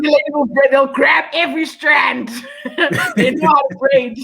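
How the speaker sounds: high, slow words breaking into laughter; real giggling delight; laughing. Real, not acted. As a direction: laugh your way through it, half shouting. Laughing about street braiders.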